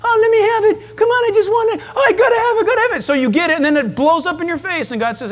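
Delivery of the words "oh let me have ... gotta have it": put-on whiny demanding child. Pastor mocking a child begging God for something. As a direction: beg like a greedy child. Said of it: "You wanna do your own thing".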